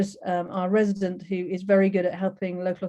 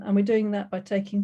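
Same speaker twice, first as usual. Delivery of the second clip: usual speaking voice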